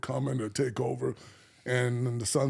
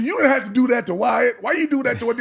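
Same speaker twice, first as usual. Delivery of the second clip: high, loud reproach; raised, pitched-up voice of protest; loud scolding; 7 semitones higher, 5 dB louder than usual. Retold or imitated. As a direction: imitate the sister telling him off. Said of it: "she did not lower her voice at all".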